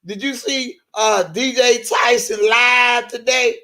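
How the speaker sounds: high, sing-song gossip voice; high mock phone gossip; Boom Boom gossiping. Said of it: "boom boom talking to Angie Walker".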